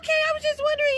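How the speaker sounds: very high squeaky put-on voice; mock her reply way up high; high-pitched imitation of the woman; mimicked nosy woman.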